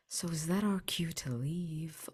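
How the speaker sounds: usual speaking voice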